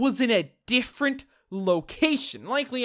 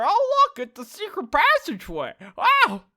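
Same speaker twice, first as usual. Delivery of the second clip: put-on high, loud half-hearted Lotta accent; mock discovery in a partial Lotta accent; 6 semitones higher, 3 dB louder than usual. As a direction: slip into a half-baked Lotta accent, pitched up at first. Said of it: "kinda like a half Lotta accent".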